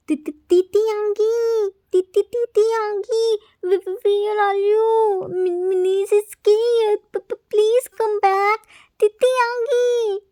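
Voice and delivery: put-on high, loud stammering cries of a frightened child; shaky scared cries. A narrator's reading. Frightened child calling for his Titi Hangi, shaky and loud. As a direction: stutter out in terror, calling for him. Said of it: "Jimin, shaky voice".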